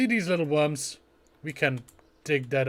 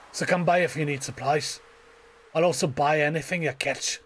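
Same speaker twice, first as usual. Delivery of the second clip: put-on old-salt fisherman voice; Willy. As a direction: greet the newcomer like a seasoned sailor. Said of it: "where we were invited by Willy".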